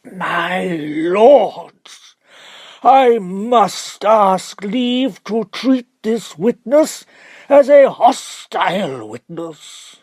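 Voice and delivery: very loud, slow, high appeal to the lords; indignant barrister. Acted. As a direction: declare it grandly to the court.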